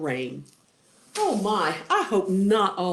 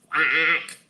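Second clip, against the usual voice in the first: drawn-out duck quack; long cartoon duck call; imitated quack; 6 dB louder than usual.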